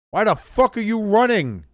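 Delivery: loud, high, furious outburst at the team; angry yell. Real, not acted. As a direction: yell it in real frustration. Frustrated fan yelling at team.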